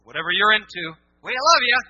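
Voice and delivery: sarcastic parody of cheap love; mock gushing. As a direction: gush it like a phony welcomer. Mocking shallow acceptance.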